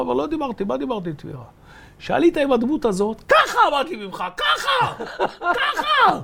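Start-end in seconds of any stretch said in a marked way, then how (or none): 2.0-6.2 much higher and louder, a hammed-up furious yell; imitating angry yell. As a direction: scold him like the tired mentor losing patience. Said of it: "והתחיל לצעוק עליי בעיניים אדומות". (חיקוי צעקה כועסת, גבוה וחזק)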